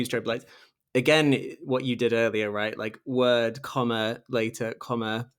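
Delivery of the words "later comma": ordinary talk, nothing marked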